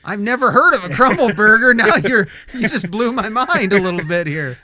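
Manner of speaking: high, loud burst of disbelief; astonished. Real, not acted. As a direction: react as if your mind is blown. Host astonished by crumble burger.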